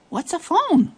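high, bewildered question; puzzled little creature. Acted. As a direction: wonder aloud, baffled.

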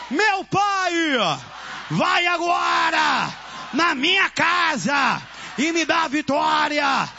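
slow, stretched shouted commands; chanting exorcist preacher, each phrase cried out; fervent deliverance prayer. Real, not acted. Leads deliverance prayer at full force.

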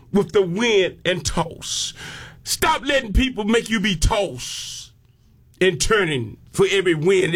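ordinary delivery

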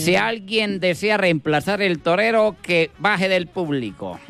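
mock announcer. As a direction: address the bullring crowd. Announcer in bullring. (voz de locutor de plaza)

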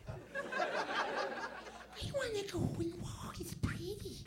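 high, airy, soft falsetto; imitated girlfriend. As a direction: plead in a tiny girly voice. Imitating girl wanting a walk.

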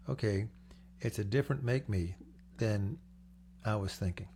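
normal talking voice

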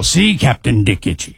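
deep, low announcer voice; commercial voice. Shown as a demonstration. Repeating it on the co-host's request, low commercial voice. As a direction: drop it right down for the ad tagline. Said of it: "Say it again".

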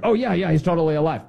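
sarcastic imitation of a denier; mock assurance. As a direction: rush out an unconvincing assurance, mockingly. Mocks the official's false assurance.

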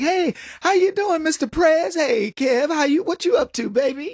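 hammed-up neighbor at a barbecue; chummy party greeting. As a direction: greet the president like an old buddy.

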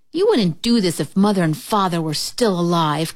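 usual speaking voice

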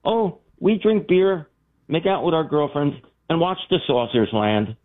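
put-on high, slow, deadpan teen answer; matter-of-factly. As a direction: say it like it's the most normal thing. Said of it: "very matter-of-factly".

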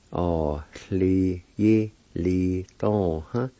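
"Ah, li, yi, li, dong, huh" in separated syllables. Shown as a demonstration. Teacher repeating the word for pending, syllable by syllable. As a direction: sound out each part deliberately. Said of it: "It's pronounced like this".

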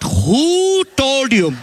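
drawn-out mock mom. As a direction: stretch out Who told you? like a menacing mom. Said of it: "She's like".

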